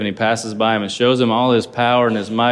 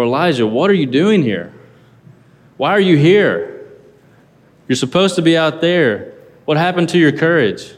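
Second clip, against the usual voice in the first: higher and louder, demanding; stern rebuke; 6 semitones higher, 4 dB louder than usual. Shown as a demonstration. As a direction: challenge him, where did your courage go. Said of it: "you could take that in so many different tones".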